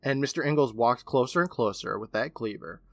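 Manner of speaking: normal talking voice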